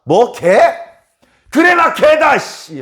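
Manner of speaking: loud, high, slow indignant retort; mock huffy comeback before storming off; offended snap. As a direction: spit it back, insulted. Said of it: "하면서 간 게 아니고".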